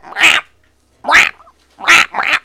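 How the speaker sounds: short, high croaked cat cries; strained cat squawk. Retold or imitated. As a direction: squawk in separate little bursts, catlike. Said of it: "strains its fucking vocal cords".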